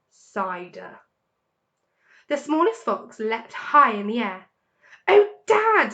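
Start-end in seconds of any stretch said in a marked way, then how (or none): 5.1-5.9 loud and much higher; cried out. A narrator's reading. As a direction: shout with thrilled delight. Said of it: "he cried out".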